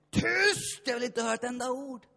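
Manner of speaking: high, loud commanding shout; booming inner voice. Retold or imitated. Inner voice silencing the praying pastor, loud shout. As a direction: bark it down like a voice from within. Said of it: "den helige ande ... som sa".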